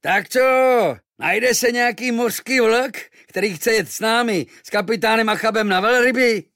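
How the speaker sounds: high, loud shouting sailor; rough sailor calling out for volunteers. Acted. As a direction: call across the harbour. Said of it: "hlasitě pokřikovali".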